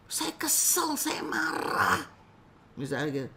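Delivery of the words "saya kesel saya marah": upset client. Acted. Client venting her anger to the counselor, lightly sulky. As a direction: mildly voice a hurt client, slowly.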